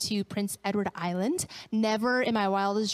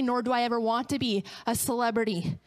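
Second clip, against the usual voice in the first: ordinary talk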